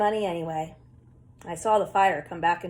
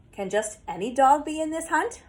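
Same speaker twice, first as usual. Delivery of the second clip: croaking; 7 semitones higher than usual. Acted. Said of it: "I croaked".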